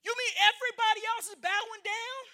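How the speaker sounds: high, startled cry of disbelief; shocked outrage. Retold or imitated. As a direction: Haman cannot believe one man won't bow. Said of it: "Haman was like".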